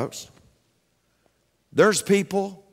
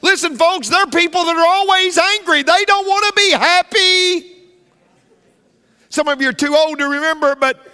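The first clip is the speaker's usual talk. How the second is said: much higher, louder, pounding out each phrase; preaching at full force; 11 semitones higher, 5 dB louder than usual. Acted. Pastor warns the congregation about angry people, preaching at full force. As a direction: drive it home like a fiery Baptist sermon.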